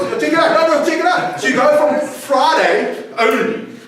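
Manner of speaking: grab the room's attention; excited call-out. Real, not acted. Preacher rousing the congregation to notice the pattern, excited.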